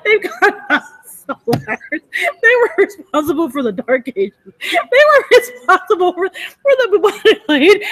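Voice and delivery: high, breaking into laughter mid-sentence; ranting through real giggles; laughing rant. Real, not acted.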